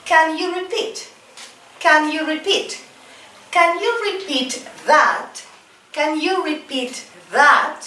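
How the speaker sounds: high, careful teacher model for repeating; slow drill model. Shown as a demonstration. Teacher drilling a class, careful slow model phrase. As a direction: model each phrase clearly for students to echo. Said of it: "let's repeat".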